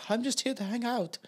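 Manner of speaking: lightly put-on Swedish accent, high; mock Swedish accent. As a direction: tease like a Swedish drifter. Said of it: "this guy's got a fucking Swedish accent".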